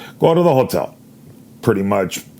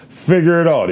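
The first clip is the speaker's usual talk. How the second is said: curt dismissal; 8 semitones higher, 4 dB louder than usual. Retold or imitated. Agent snapping back at him.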